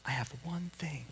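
hushed, whispered cue; feed the line under your breath; whispered prompt. Shown as a demonstration. Pastor prompting a child in the group psalm, whispered.

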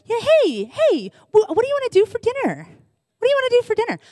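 high-pitched put-on lollygagging spouse voice; mock clueless spouse. Spouse lollygagging into the kitchen on Christmas, high mock-casual voice.

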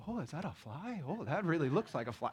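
soft, wide-eyed fish voice; hushed, half-playful fish; naive little fish. Retold or imitated. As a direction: coo like a gullible fish eyeing bait.

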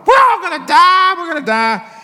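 high, loud mock yell of terror; hammed-up cry of alarm; imitated panicked hollering. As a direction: holler like sailors sure they'll drown. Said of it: "The guys are hollering".

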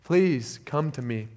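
soft, slow, tender "please come to me"; gently invite, softly; hushed loving plea as Jesus. Pretend. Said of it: "He's saying".

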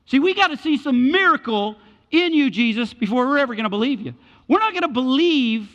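high, loud, scornful demand; mocking crowd's cry. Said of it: "And they're crying out".

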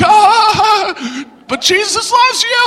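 very high, mock-whimpering comeback; helpless protest. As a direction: plead it in a hammed-up high voice. Speaker's feeble defence against the atheist.

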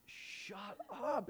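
playful high-pitched shut up at the party; high mock disbelief. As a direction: toss it up high in fake outrage.

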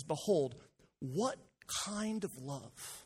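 each word weighted, kind of love drawn out slowly; reading the verse aloud slowly for emphasis; slow emphatic reading. Shown as a demonstration. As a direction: lean on Behold, what kind of love, word by word. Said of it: "That, that word there".

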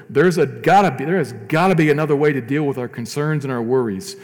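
loud, rising sermon climax; preaching at full force. Acted. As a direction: drive it home from the pulpit, full voice.

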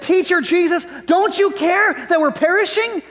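high-pitched panicked plea; freaking out. Acted. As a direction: cry out to the teacher in alarm. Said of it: "No, they're freaking out".

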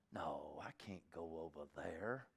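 low, soft mumbled excuse; reluctant inner voice. Pretend. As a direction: talk yourself out of it under your breath. Voicing reluctant inner thought.

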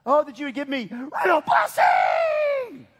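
high, loud announcer-style shout; emphatic shout. Retold or imitated. Preacher shouting Jabez's prayer like game-day hype. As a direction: cry it out like a touchdown call. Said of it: "That's kinda what this is".

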